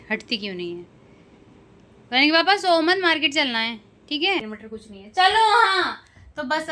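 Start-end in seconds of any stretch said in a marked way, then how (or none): 2.1-4.4 calling out. Real, not acted. Wife calling sleeping husband.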